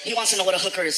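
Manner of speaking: ordinary talk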